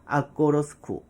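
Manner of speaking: "I go to school"을 '아꼬러스쿠'로. Shown as a demonstration. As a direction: shorten "I" to "ah" and flap "to" into "ro". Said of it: "뒤에 있는 이 생략하면 아". ('I'는 '아', 'to'는 '러'로 이어서)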